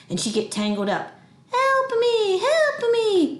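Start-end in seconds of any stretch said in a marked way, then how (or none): 1.5-3.4 high-pitched fly-sized squeak for help; squeaking tiny fly. Acted. Grandma caught in a web playing the fly, squeaking. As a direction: squeal it like a trapped little bug. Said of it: "she'd squeak like the fly".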